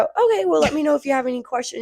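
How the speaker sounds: imitating high, quick customer-service politeness; retold over-polite helpful worker, pitched well up; nice and kind. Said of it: "I was really nice and kind".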